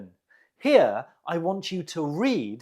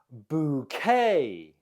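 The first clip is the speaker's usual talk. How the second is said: "Bouquet" with stress on the final -quet; second-syllable stress; 3 semitones lower than usual. Shown as a demonstration. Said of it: "The stress is on the second syllable".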